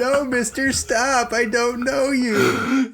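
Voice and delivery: high, slow whiny plea; mock pleading victim. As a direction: beg the stranger to stop. Acted plea to sprinkler man.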